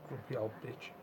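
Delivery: quiet under-the-breath jab; muttered insult. Real, not acted. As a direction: grumble it quietly to yourself. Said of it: "Sorry, that was really bad".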